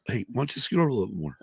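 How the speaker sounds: normal talking voice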